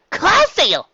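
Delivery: high, loud shout of a guess; a filly shouting a wild guess in class; blurted answer. Acted.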